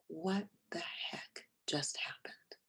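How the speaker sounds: breathless whispered disbelief; hushed inner thought of shock; stunned whisper. Retold or imitated.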